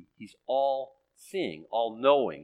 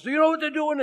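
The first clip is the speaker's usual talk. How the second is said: loud, high-pitched mock alarm; imitated freaking-out mom; 7 dB louder, faster than usual. Mom bringing the daily Satan report, mock panic. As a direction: impersonate a mom in a panic, much higher. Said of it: "her thinking is like".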